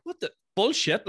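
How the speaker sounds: high, indignant cry of disbelief; mock outrage. Wrestler told he must lose, mock outraged disbelief. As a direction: squeal it like a cheated fighter. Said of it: "You'd be like".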